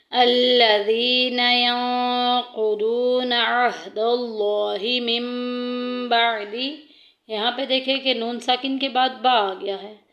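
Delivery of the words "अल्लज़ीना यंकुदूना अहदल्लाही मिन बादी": slow melodic tilawat with long vowels; Quranic recitation. Acted. Teacher reciting the verse for students, slow and melodic. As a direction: draw the words out in a chant.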